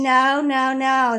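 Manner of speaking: retold child scream. Retelling daughter refusing to leave, mock scream. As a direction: echo the girl's frantic no. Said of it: "Gianna is screaming".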